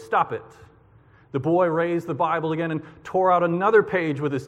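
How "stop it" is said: ordinary talk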